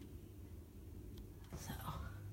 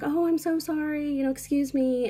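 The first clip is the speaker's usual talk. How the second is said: overly polite apology; 10 semitones higher, 4 dB louder than usual. Retold or imitated. Quoting her own polite apology.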